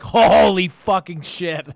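loud, drawn-out stunned swearing; retold shock. Retelling seeing the bleeding man on a rock, stunned exclamation. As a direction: stretch the first word in mock horror.

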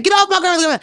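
high yelled plea; retold scream. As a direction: shriek it like a scared kid. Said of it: "I'm screaming".

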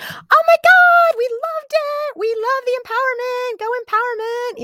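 put-on high-pitched girly gushing; gushing teen girls. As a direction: imitate giddy teenage girls. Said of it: "they were like".

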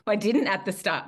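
usual speaking voice

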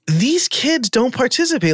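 high exasperated complaint; retold teacher frustration. Teacher retelling frustration with class.

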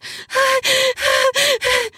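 long high moan of pleasure. Acted. Woman climbing toward orgasm, long high moan.

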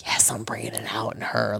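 low, whispered inner gloating; secret triumph. Acted. Submissive to herself as the girl grows bolder, whispering. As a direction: whisper it to yourself, pleased. Private thought during the kiss.

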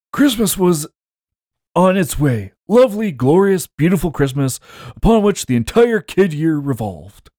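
lightly voiced old man, pitched high; a hint of a storytelling old man. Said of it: "do 'em in an old man voice".

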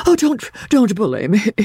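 high, plaintive invalid's protest; helpless pleading. A narrator's reading. Invalid begging his visitor to stop, plaintively helpless. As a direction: plead as if being bullied. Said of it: "falling back helplessly in the chair".

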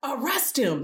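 high-pitched royal order. A narrator's reading. King sending Micaiah to prison, ordering. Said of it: "the king of Israel ordered".

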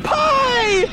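high desperate shouting; anguished cry. Acted. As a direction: scream it out in despair. Crying out a farewell.

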